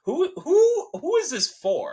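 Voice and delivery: high, loud incredulous questioning; exasperated disbelief. Retold or imitated. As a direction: stammer it out in baffled protest. Acts out baffled viewer reaction.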